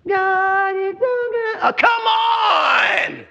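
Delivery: high, drawn-out praise turning into a wail; put-on worship, then cry out in frustration; hammed-up slow, high exasperated whine; mock pious exasperation. Mocks singing while inwardly upset.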